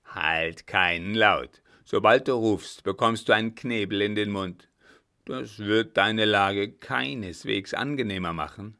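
loud, forceful warning; loud, menacing command; threatening brother. A narrator's reading. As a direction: order silence with full voice. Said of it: "sagte ihr Bruder schnell".

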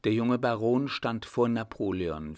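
usual speaking voice

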